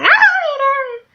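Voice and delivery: slow, very high, put-on sing-song; mock drawl. Mocking Sophia offering to read it aloud, slow and squeaky. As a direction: stretch it out in a silly high cartoon voice. Friend insisting again, mocked.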